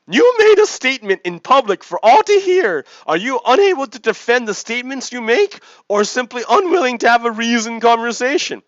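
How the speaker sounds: high, loud, fake-polite sea lion. Sea lion answers the annoyed man, high and loud. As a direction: squeak it out with mock courtesy. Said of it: "sea lion keeps pestering".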